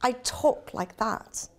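slow, careful put-on British accent; English accent. Shown as a demonstration. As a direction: try out an English accent, drawn out. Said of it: "That would be my English accent".

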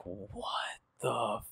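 slow, drawn-out retold disbelief; stunned disbelief. Storyteller replays his reaction to the accusation, stunned. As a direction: stretch it out softly, as if stunned.

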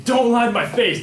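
high, loud angry yell; retold scream. Enraged guy screams at storyteller before pushing him, yelling. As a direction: imitate someone screaming an accusation. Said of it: "he screams at me".

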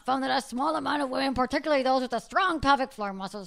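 loud, hammed-up German accent. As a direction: read it like a German professor. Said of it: "let me get in my German accent".

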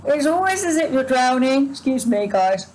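words through a yawn. Real, not acted. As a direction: yawn while talking. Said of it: "I'm yawning a lot".

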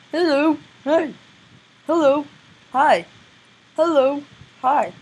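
loud, high, slow repeated hellos; Foxy greeting. Retold or imitated. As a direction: call out each greeting as a cartoon character. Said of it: "And he's like".

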